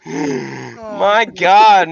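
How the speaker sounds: ordinary delivery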